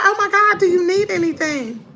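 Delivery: put-on high voice of shocked concern; imitated shock. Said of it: "she said it like she was shocked".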